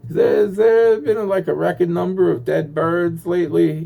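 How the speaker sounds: high, drawn-out faux-casual wondering; mock innocent question. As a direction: act like you just noticed, sweetly naive. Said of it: "I was just like".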